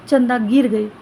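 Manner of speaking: ordinary delivery, nothing marked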